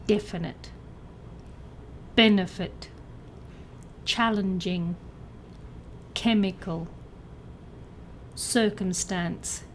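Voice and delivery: "Definite. Benefit. Challenging. Chemical. Circumstance.", each stressed on its first syllable. Shown as a demonstration. Teacher reads out answers, first-syllable stress from "Definite" to "Circumstance". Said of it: "with a stress on the first syllable".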